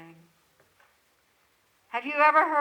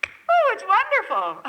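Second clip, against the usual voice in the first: high, loud exclamation breaking into a laugh; real, joyful laughing delight; 10 semitones higher, 7 dB louder than usual. Real, not acted. Delighted at being recognized, laughs.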